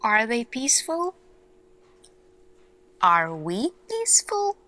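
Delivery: ordinary talk, nothing marked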